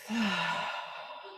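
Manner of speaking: long, airy sigh through the mouth. Shown as a demonstration. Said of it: "sigh out through the mouth".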